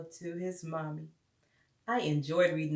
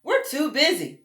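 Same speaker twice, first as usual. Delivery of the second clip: loud, brisk flock brushing him off; sheep refusing; 9 dB louder, much slower than usual. Acted. Sheep turning Douglas down, loud and brisk. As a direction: say no like busy sheep.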